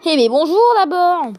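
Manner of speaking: loud, high indignant cry; outraged you didn't say hello; indignant outburst. Real, not acted. Mock indignation at a card.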